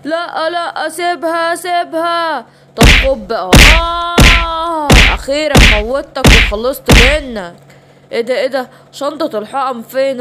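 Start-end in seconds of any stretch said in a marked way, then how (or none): 2.8-3.5 villain shout. Acted. Villain strikes with injection. 3.8-4.9 long drawn-out cry of pain. Acted. Victim hit by injection. 5.1-7.6 gloating villain. Acted. Villain gloats over victim.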